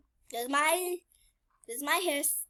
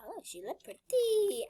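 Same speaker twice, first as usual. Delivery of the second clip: ordinary talk, nothing marked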